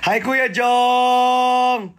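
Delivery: high, slow, delighted call out; excited greeting. Real, not acted. Greeting Kuya Jong in the live chat, with a high, delighted shout. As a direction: call his name with glee.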